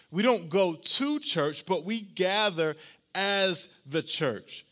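slow, stressed contrast between don't go and gather; emphasis on go to and gather. Shown as a demonstration. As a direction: lean hard on "don't" and on "gather". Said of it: "And I put the emphasis there".

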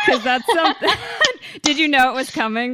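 laughing hard through words; high, loud laughing. Real, not acted. Laughing while telling story.

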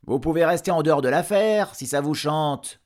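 high, loud dismissal; irritated retort. Acted. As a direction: brush off the doomsayer loudly. Villagers snap back at the blacksmith. (réplique agacée, forte et aiguë)

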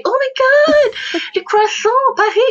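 very high, slow, squealing mock rapture with a laugh; thrilled American. Guest imitates Americans swooning over France, squealing high. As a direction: ham up a starstruck American, stretched out. Americans reacting to her French CV.

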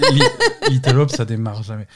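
laughing while saying it; amused laugh breaking the words. Real, not acted. Laughs mocking the previous game.

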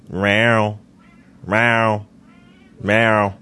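drawn-out mock meows, evenly spaced; imitated cat meow. Imitates his cat mid-intro.

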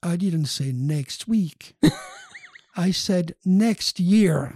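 slow, deliberate retold professor's correction; drawn-out punchline in the professor's words. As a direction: spell it out slowly for a mistaken student.

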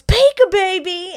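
high, loud, drawn-out playful shout; cat-man's pouncing cry. Retold or imitated. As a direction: pounce on it like a crazed cat-man. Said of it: "He jumps up and he goes".

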